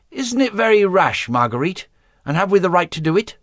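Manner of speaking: high, quick, worried voice; anxious young woman. A narrator's reading. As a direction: ask it fast and high, fretting. Worried princess questions her sister.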